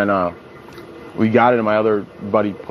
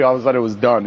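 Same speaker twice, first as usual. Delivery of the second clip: normal talking voice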